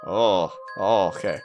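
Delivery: usual speaking voice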